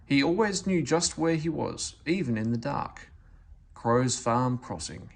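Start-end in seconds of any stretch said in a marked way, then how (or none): none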